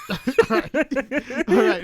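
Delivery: giggling all right through laughter; laughing. Real, not acted. Host cracking up at cohost's spoiler joke, laughing. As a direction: laugh it out, high and breathy.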